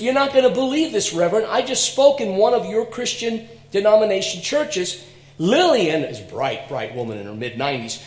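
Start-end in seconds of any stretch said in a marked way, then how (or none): 0.0-5.0 excited greeting. Retold or imitated. Lillian excitedly greets minister.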